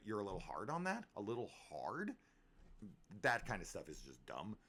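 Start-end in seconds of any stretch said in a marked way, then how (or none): none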